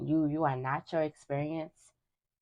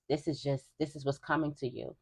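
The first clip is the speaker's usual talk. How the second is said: usual speaking voice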